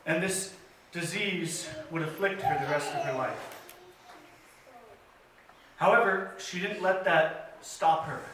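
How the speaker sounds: usual speaking voice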